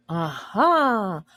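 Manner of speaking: the father exulting over the answer; exultant aha. A narrator's reading. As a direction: crow it in triumph. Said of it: "Magnus Bede exulted".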